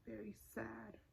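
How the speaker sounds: ordinary delivery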